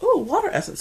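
high-pitched mock delight; retold excitement. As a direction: jump up high, imitating your first reaction. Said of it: "I was like".

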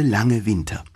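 plain reading voice

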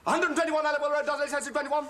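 quick, brisk repeat of the address; fast repeat. Acted. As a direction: rattle it off quickly.

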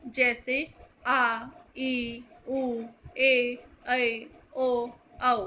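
आ, ई, ऊ, ए said drawn out, held twice as long; stretched long vowel drill; long vowels demo. Shown as a demonstration. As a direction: hold each long vowel. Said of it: "दीर्घ स्वर कहते हैं".

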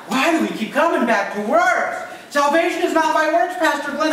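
high, loud protest to the pastor; objecting congregant. Acted. Congregant objecting to Pastor Glenn about works, high loud protest.

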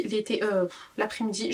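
ordinary delivery

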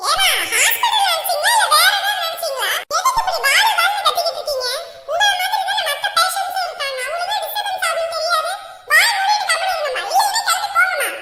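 high, loud scolding at the bedside; a nurse yelling at a patient's family to shut up. Acted. Nurse scolds noisy relatives.

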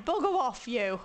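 ordinary delivery

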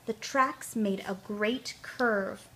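usual speaking voice

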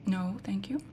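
hushed no thank you; soft unvoiced whisper. Real, not acted. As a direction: whisper it so nothing hears. Whispering to a game character.

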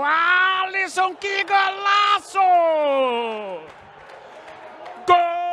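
shouted goal call; explosive goal commentary. Real, not acted. Goal scored, narrator erupts.

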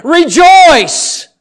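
loud, high shout; sudden pulpit cry, far above normal pitch. Shown as a demonstration. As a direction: shout it out to rouse the congregation. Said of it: "I'm gonna say it again".

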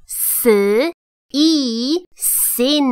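"scene" built slowly from "s", long "ee", then drawn out; sounding out. Shown as a demonstration. Teacher shows learners the silent-c word, sounding out slowly. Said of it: "所以它是一的长音，e".

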